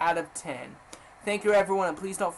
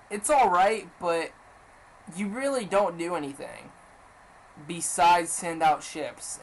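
usual speaking voice

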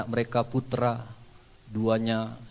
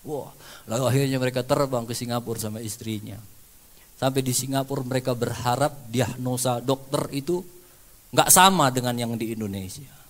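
usual speaking voice

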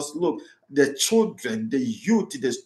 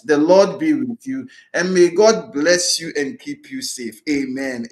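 louder, proclaiming blessing to the congregation; priestly blessing; 4 dB louder than usual. Acted. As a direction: bless them with full solemn voice. Priest gives closing blessing.

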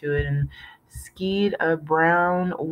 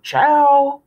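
a huge bellow, much higher and louder; 11 semitones higher, 9 dB louder than usual. A narrator's reading. Father bellowing for the kids to eat. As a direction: roar the one word out. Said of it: "he bellowed".

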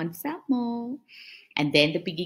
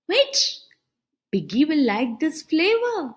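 higher, lively cartoon elephant; elephant's voice; 7 semitones higher, 4 dB louder than usual. Elephant torn over sharing ice cream, higher cartoon voice. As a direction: play the greedy little elephant thinking aloud.

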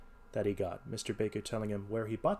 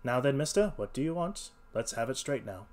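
ordinary delivery

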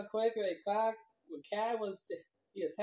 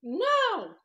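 high squeaky fish shouting no; angry fish; 10 semitones higher, 5 dB louder than usual. Acted. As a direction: yell it like a tiny outraged fish. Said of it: "The fish says".

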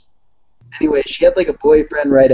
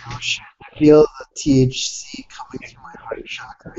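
whisper; 13 semitones higher than usual. Pretend. Stoned joke about THC.

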